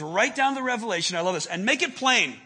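loud, high declaration; proclaiming God's command. Acted. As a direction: boom it out like a divine order. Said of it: "He says".

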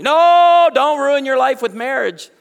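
high, loud mock alarm, yelling no; hammed-up panic at the thought of marriage; alarmed shout. Church elder warning a thirty-year-old off marriage, loud mock alarm.

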